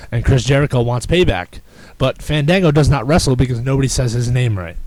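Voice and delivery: ordinary talk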